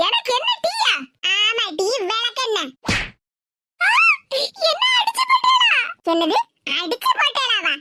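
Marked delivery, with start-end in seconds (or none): 0.1-0.9 high, wounded repeat of the jab; offended echo. Acted. Neighbour echoes, offended. 3.8-5.8 wailing cry. Acted. Daughter-in-law cries out loudly.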